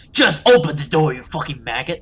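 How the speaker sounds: loud, furious snarl at the door; the mask slips into a roaring demon; loud furious shout; monster rage. Acted. Monster drops disguise, screams.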